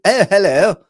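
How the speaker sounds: hammed-up posh English, high and loud; mock a posh English stage accent. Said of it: "Like a posh kind of".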